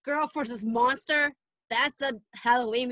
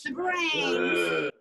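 low moaning zombie cry; 8 semitones lower than usual. Pretend. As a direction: groan it low like the undead. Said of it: "Everybody do your best zombie impression".

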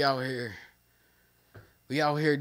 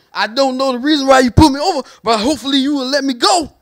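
nervous pleading driver; 8 semitones higher, 7 dB louder, faster than usual. Retold or imitated.

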